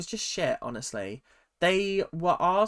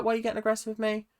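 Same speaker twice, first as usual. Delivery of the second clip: high, very fast indignant mock Sarah; 8 semitones higher, much faster than usual. Vlogger mimics Sarah protesting to Marcello, high and rushed. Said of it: "no wonder she was like".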